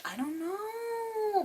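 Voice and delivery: high, soft, stretched-out singsong; drawn-out uncertainty. Real, not acted. Reviewer unsure how she'll use a brush, drawn-out high singsong. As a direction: let it float up high and stretch it out.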